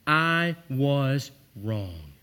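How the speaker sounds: very slow, weighty I was wrong; deliberate confession; slow sincere admission. Shown as a demonstration. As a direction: lay each word down slowly. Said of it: "Here they are".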